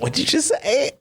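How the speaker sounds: high, shocked what did you say; shocked disbelief. Real, not acted. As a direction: gasp at what you just heard. Reacting to odd word.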